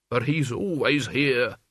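put-on slow, plaintive old servant; Albert, whining old man. Albert insisting his master is always here, plaintive whine. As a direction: drag the words out plaintively.

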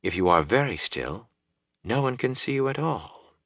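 soft, calm woman's voice; quiet. A narrator's reading. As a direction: speak softly, not the least bit worried. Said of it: "Celine said quietly".

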